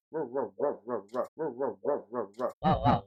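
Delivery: low rhythmic "ruh-ruh" nonsense noise; repeated ruh noises. Pretend. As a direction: churn out ruhs in a looping pulse. Makes repeated silly noise.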